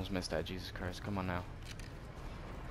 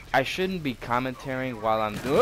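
ordinary delivery

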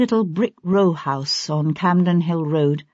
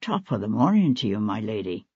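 cheery man's voice; 7 semitones lower, 4 dB quieter than usual. A narrator's reading. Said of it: "a man's cheery voice".

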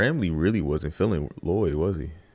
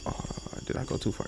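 whisper; 8 dB quieter than usual. Real, not acted. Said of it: "worried aside".

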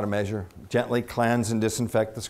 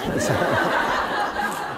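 breathy laugh; 7 semitones higher than usual. Real, not acted. Laughs at her own punchline.

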